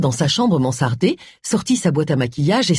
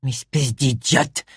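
enraged; 5 semitones lower, 4 dB quieter, slower than usual. A narrator's reading. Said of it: "enragea missus Fawkes".

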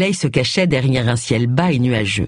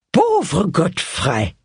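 put-on very slow, stricken old-lady lament; dismayed old aunt; 2 semitones higher, much slower than usual. A narrator's reading. Old aunt mourns her murdered neighbour, slow and stricken. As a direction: let the name fall out in shocked grief.

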